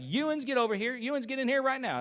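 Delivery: high mock call from the house; mom calling the kids. Preacher voicing his mom calling the kids inside, high-pitched. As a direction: play a country mom summoning the kids.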